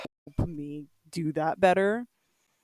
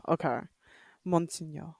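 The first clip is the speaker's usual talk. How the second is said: ordinary delivery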